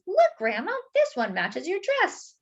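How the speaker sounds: put-on a girl showing the donut to her grandma; little girl. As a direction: chirp it like an eager little girl. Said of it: "This girl says".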